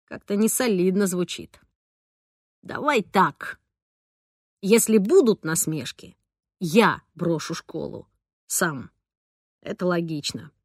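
a hint of a low, sullen boy; lightly voiced boy. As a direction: sullen teen setting terms. Boy's voice voiced lower.

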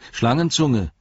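earnest call by name. Acted. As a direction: call after someone to stop them. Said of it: "rief Frodo".

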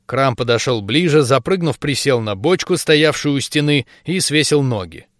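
usual speaking voice

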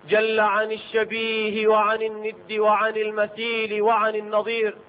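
ordinary delivery

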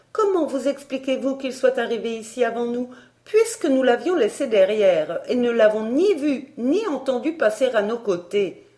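high, argumentative governess voice; insistent Miss Barbara. Acted. The governess arguing with Elsie, insistent.

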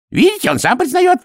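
very high, excited exclamation; triumphant outcry. A narrator's reading. Priest seizes on the golem's admission, triumphant.

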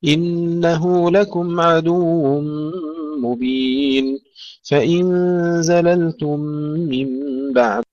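slow, melodic tajweed chant; Quran recitation. Shown as a demonstration. Teacher reciting the verses for students, slow chanted recitation. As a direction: chant it slowly, drawing out the long vowels.

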